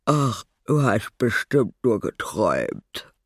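sleepy father talking through a yawn. A narrator's reading. Papa dismissing the noise at night, yawning. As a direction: sigh it out with a big yawn. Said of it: "sagte Papa gähnend".

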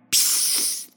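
soft, unvoiced psst like leaves; rustling hush. Old oak hushes the hare, a rustling whisper. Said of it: "raschelte der Baum".

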